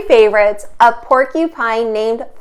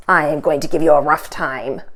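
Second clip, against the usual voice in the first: gruff rhino; 7 semitones lower than usual. Acted. Big rhino threatens porcupine.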